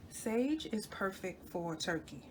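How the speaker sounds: normal talking voice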